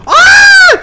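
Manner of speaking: very high, loud real shriek; terrified scream. Real, not acted. Gamer startled by the monster, shrieking loudly. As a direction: scream out in sudden fright. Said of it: "Allah'ım, bağırma".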